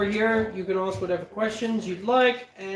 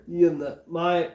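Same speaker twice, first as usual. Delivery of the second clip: ordinary talk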